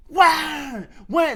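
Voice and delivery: loud, high mock crying "wah"; imitated kid's wail. Comedian mimicking a crying kid at the barber shop, loud mock wail. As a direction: burst out with a piercing pretend wail. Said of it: "it wasn't like, it wasn't a kid going like".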